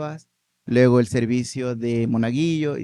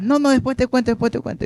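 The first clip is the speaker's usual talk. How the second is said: higher, quick dismissive reassurance; hurried brush-off; 11 semitones higher, faster than usual. Retold or imitated. Grandson hurrying off the bus, brushing off his grandmother. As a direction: wave her off as you rush away.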